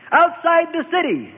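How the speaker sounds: high-pitched shouted order; Annas shouting. A narrator's reading. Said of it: "Annas shouted".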